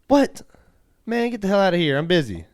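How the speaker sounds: high, loud brush-off; irritated shout back. Retold or imitated. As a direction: play a busy dad yelling through a door. Acted dad shouting back through door.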